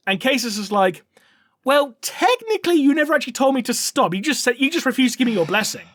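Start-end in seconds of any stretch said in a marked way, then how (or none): 1.6-5.8 much higher, wheedling excuse; pedantic whine. Retold or imitated. Casus excusing himself to Dante, higher pedantic whine. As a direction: weasel out on a technicality.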